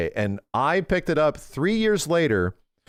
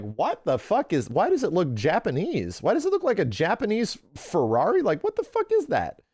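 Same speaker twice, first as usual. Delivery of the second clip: rising disbelief; 6 semitones higher than usual. Retold or imitated. Retold shock at the picture.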